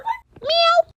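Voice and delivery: high squeaky cat meow in place of the word; censoring meow. Pretend. To viewers, censoring a body word with a meow. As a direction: bleep it with a meow.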